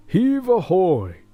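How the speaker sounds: loud, slow sailors' call; shouted. Acted. Sailors hauling chests calling out loud and slow. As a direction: haul and bellow it out. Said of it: "they shouted".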